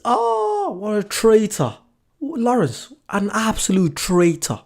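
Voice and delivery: high, slow, hammed-up cry of betrayal; mock outrage. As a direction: play the scandalised accuser, half laughing. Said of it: "I'm only messing".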